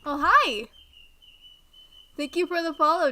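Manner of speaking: loud, high happy hi; excited greeting. Real, not acted. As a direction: greet a new follower loudly. Greeting a new follower.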